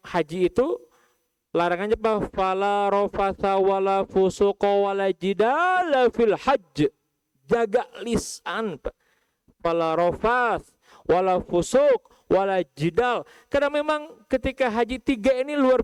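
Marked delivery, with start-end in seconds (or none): 2.3-13.3 chanted verse in a held tone; Quran recitation. Acted.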